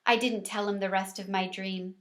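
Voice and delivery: ordinary delivery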